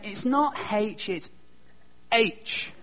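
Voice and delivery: imitating a child correcting "haitch" to "aitch", slowly; H corrected to "aitch". Five-year-old son correcting his father, pointed "aitch" for H. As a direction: say "H" first as "haitch", then as a clean "aitch". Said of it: "made fun of my accent".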